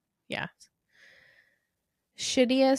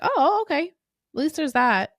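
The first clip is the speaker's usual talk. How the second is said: surprised reaction; 6 semitones higher, 4 dB louder than usual. Retold or imitated. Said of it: "I was like".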